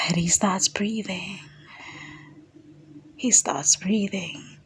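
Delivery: soft whispered retelling; quiet breathy whisper; hushed whisper. Retold or imitated. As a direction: whisper it as if reliving it.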